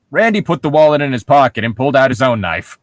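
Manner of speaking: normal talking voice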